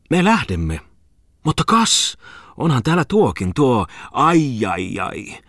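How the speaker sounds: high, excited exclamations without real shouting; crying out. A narrator's reading. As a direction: call out on suddenly spotting someone, pitch leaping. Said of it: "huusi hän". (korkealla äänellä huudahdellen)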